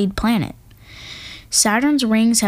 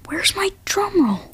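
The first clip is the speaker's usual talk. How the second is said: breathy stage whisper, higher; 11 semitones higher, slower than usual. Acted. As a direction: hush it like a secret aside. Secret aside before big reveal.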